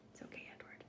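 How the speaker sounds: whispered comfort; soft whisper. Real, not acted. Teacher consoling the sad emu, whispered. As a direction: breathe it gently to the picture.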